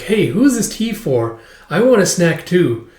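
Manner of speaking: put-on high, slow child voice; curious little girl. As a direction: pipe up like a kid wanting a treat. Child wants a snack.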